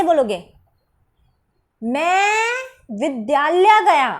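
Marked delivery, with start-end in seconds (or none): 1.8-4.1 "मैं" held very long and rising, then the rest; stress on मैं. Shown as a demonstration. Teacher modelling for students, long heavy stress on "मैं". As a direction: stretch "मैं" out slowly before "विद्यालय गया". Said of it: "पे बल दो".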